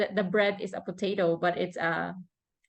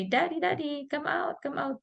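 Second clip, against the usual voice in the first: calling daddy; 6 semitones higher, 5 dB quieter than usual. Retold or imitated. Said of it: "So he would say".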